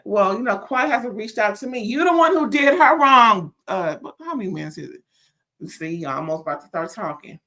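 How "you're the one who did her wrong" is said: loud, higher-pitched accusation; heated scolding. Real, not acted. Reviewer telling off Doctor Simone, heated scolding. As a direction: raise pitch and volume to call her out.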